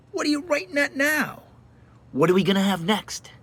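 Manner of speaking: high-pitched mimicry of pestering friends; mimicked nosy friends. Mimics friends questioning the writer.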